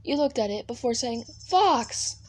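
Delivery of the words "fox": higher-pitched exclamation of a name; sudden high call-out; exclaimed name. Acted. Calling out Vox's name.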